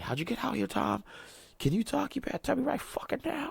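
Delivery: very high, frantic squeaky voice; shrill paranoid falsetto interrogation; panicked movie hero. Retold or imitated. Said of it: "He's like".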